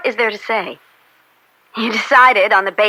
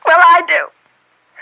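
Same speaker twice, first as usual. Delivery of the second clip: slow, higher, louder cutting reply; bitter retort; 9 semitones higher, 5 dB louder, much slower than usual. Acted. Daughter accusing her father of taking a bribe, bitterly. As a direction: throw it back at him.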